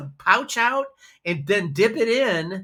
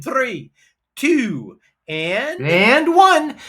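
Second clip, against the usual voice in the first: countdown callout; 5 semitones higher, 6 dB louder, much slower than usual. Real, not acted. Counting down the video break.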